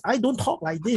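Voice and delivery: lightly mocking Chinese accent demo. Shown as a demonstration. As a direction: send up the accent he says he doesn't have. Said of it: "they talk like that".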